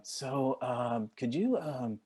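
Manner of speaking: soft, halting imitation of an awkward request; hesitant casting director. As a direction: tiptoe into the question, quietly, with ums.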